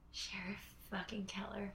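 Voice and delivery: hushed, slow dramatic reveal; dramatic whisper. Real, not acted. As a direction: breathe the name like a big reveal. Dramatic reveal after moment of silence.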